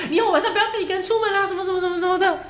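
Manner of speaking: high, fast, fretting mom voice; anxious mother. Retold or imitated. Mother scolding her daughter about going out alone, high rapid fretting. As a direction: rattle it off like a worried mom. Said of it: "然后我妈就很紧张说".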